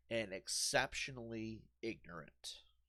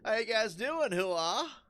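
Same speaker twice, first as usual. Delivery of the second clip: high, loud, hammed-up mock soldier chat; mocking the game's hooah; 9 semitones higher, 8 dB louder, slower than usual. Gamer mocking the game's soldiers, high loud parody. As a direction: ham up the silly small talk, sneering. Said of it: "who the fuck talks like that?".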